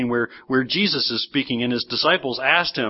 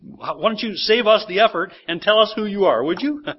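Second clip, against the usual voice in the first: exasperated, higher-pitched pleading demand; frustrated questioners; 4 semitones higher than usual. Acted. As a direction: act out fed-up questioners giving up on guesswork. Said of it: "You sense their frustration here".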